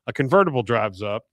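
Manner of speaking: usual speaking voice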